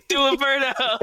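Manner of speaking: high, excited call to the cops; egging them on with a grin; cheering shout. Retold or imitated.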